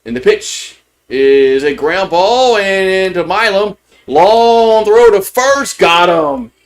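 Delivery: live baseball call, climbing with the play; excited play-by-play. Real, not acted. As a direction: call rising high and loud, drawn out into the out.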